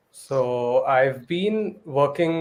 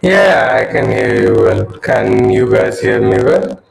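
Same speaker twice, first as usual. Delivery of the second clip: garbled echoing call audio; like a glitchy robot on a bad line; robotic voice; 3 semitones lower, 8 dB louder than usual. Real, not acted. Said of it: "like a robotic voice".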